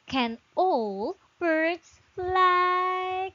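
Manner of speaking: slow, drawn-out teacher model; slow model reading. Shown as a demonstration. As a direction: spell it out slowly for the pupil. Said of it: "Can you say it again?".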